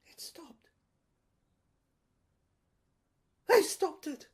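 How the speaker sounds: high, loud, unhinged tycoon rant; a shrill, loud madman's tirade; ranting villain. Acted. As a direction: rave like a cornered mogul losing his grip. Raving villain monologue.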